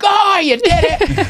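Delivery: put-on high, slow gushing praise to a kid; excited cheering. Uncle praising nephew's first goal, excited and high. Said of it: "And I'm like".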